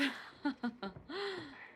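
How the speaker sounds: high, soft chuckle; light amused laugh. Acted. [name] jokes with caller about work, amused laugh. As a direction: laugh gently at your own quip. Joking about earning the keep.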